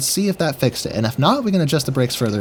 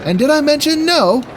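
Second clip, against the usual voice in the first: high, sarcastic aside; mock exasperation; 13 semitones higher, 3 dB louder than usual. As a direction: ask it with playful exasperation. Mock-exasperated rhetorical question.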